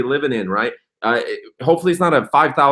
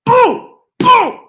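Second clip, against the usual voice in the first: much higher, louder triumphant boom; shouted boom; 7 dB louder than usual. Real, not acted. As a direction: yell it like a victory cry. Celebratory shout.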